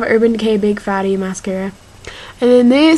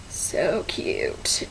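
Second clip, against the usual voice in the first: soft unvoiced whisper; 6 semitones lower, 8 dB quieter than usual. Real, not acted. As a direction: breathe it out in a whisper. Whispered aside over purchases.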